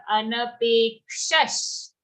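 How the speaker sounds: anapekshash closing on a bare "sh"; half sha. Shown as a demonstration. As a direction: slowly model anapekshash, no vowel after the last sh. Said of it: "we have to say half sha".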